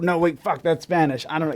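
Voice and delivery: usual speaking voice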